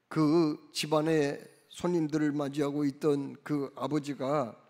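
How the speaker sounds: usual speaking voice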